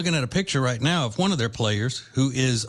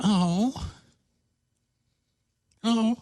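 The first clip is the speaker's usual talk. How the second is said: sympathetic coo; 9 semitones higher than usual. Retold or imitated. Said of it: "she makes you go".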